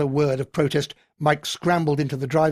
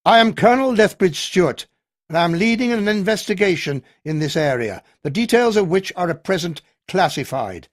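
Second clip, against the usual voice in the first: loud, higher military announcement; authoritative officer; 4 semitones higher, 6 dB louder than usual. A narrator's reading. Colonel addresses the hostile locals, firm and loud. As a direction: take command of the room.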